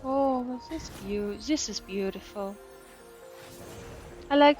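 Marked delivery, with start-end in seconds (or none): none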